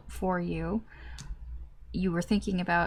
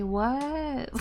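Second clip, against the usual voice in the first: baffled what; 6 semitones higher than usual. Retold or imitated. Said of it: "you're just like".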